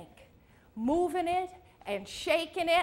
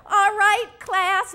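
raised, higher call to the class; teacher's shout; 6 semitones higher, 5 dB louder, slower than usual. Acted. Zumba teacher rallying her class, a mock shout. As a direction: call out over a room as the teacher.

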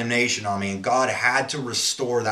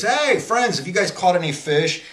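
high, louder call across water; 7 semitones higher, 3 dB louder than usual. Acted. Jesus calling from the shore to the disciples, high call. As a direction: call out to friends on a boat.

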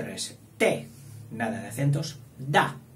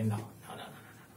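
very soft, low string of refusals; voiced objecting character; 6 semitones lower, 12 dB quieter than usual. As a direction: mutter objections that fade to near nothing. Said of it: "Y este dijo".

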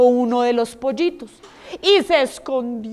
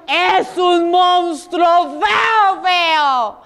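very slow, stretched-out wail; alarmed chick; 3 semitones higher, much slower than usual. Acted. Fourth chick describing the ugly monster, wailing alarm. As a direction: drag every word out in mock alarm.

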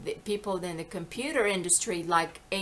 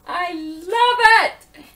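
loud, high, drawn-out delight; gleeful exclamation; 11 semitones higher, 13 dB louder, much slower than usual. Real, not acted. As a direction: belt out your love, stretching it. Exclaims delight about her accents.

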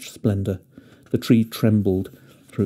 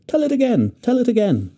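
excited shout; 13 semitones higher than usual. Acted. Children clapping and begging for the story again, high excited shout. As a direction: cheer for more like delighted kids. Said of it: "clapped their hands and shouted".

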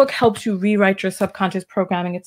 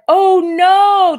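high, loud mock dismay; mock horrified friend; 11 semitones higher, 8 dB louder than usual. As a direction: wail it in fake shock. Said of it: "the dude is like".

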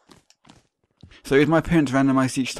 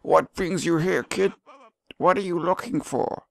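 put-on higher, drawn-out old man voice; old man character; 7 semitones higher, slower than usual. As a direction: question them as an old cave dweller. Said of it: "It's an old man".

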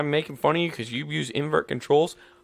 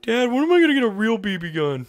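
put-on whiny kid voice; 10 semitones higher, faster than usual. Voicing the whiny son.

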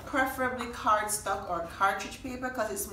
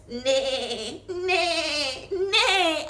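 neighing higher and louder each time, ending in a laugh; whinny like a horse, building up; 7 semitones higher, 7 dB louder, much slower than usual. Pretend. Reading a farm book aloud, neighing like a horse. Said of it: "I say".

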